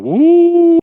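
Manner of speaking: high eerie ghost woo; a spooky moaning ghost woo; ghostly woo. Retold or imitated. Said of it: "he's going".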